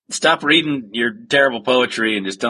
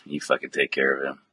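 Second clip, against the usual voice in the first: low, grumbling brush-off; deadbeat dad; 8 semitones lower, faster than usual. Acted. Satan dumping his kid on others, low weary grumble. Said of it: "He's like".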